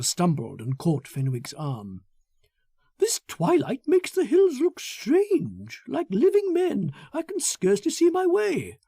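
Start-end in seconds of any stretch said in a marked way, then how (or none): 3.0-8.8 nervous Foster. Acted. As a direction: pitch him up and let the dusk unsettle him. Foster uneasy on darkening hills.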